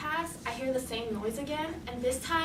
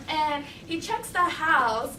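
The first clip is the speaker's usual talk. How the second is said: ordinary talk, nothing marked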